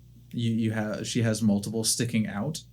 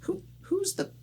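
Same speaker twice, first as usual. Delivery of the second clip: young gnome, mock offended.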